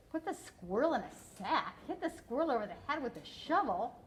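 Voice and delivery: ordinary talk, nothing marked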